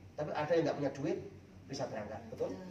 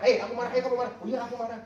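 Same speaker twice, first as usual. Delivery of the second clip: raised, higher-pitched mock quarrel; mock angry; 7 semitones higher, 6 dB louder, faster than usual. Husband parroting his angry wife, mock angry. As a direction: imitate a couple firing anger back at each other. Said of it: "Kita remote, istri marah".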